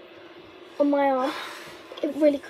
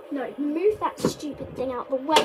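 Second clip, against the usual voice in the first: usual speaking voice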